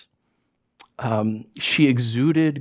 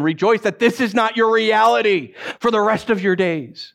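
raised-pitch preaching climax; impassioned exhortation; 6 semitones higher than usual. Real, not acted. Preacher urging the fearful to rejoice, impassioned exhortation. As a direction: drive it home, pitched high.

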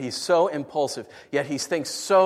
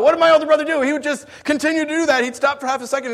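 excited high loud; 12 semitones higher, 8 dB louder, faster than usual. Acted. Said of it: "excited retelling".

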